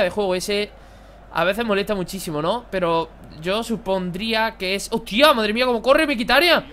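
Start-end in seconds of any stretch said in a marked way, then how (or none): 4.9-6.7 louder, higher burst of real surprise; surprised outburst. Real, not acted. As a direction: blurt it out in amazement. Sudden sprint by rival player. (exclamación sorprendida, más alta y aguda)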